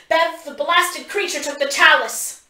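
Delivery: high, loud cursing shout; exasperated yell; angry shout. A narrator's reading. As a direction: shout it in frustration. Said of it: "shouts from somewhere behind us".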